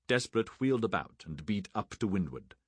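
ordinary delivery

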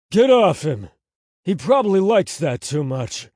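bellowed order, then a loud jeer; angry shout. A narrator's reading. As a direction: shout it furiously at your son. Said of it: "their dad shouted at Anthony".

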